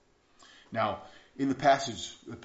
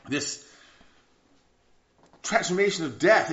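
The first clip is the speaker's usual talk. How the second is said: louder, higher, driving preacher's cadence; preaching at full force; 6 semitones higher, 7 dB louder than usual. Acted. Pastor pressing the congregation on sin, loud and fervent. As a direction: hammer the point home from the pulpit.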